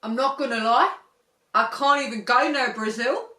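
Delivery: loud, laughing mock Aussie confession; mock Australian shark. Australian accent, shark confessing at rehab, half laughing. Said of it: "they're like".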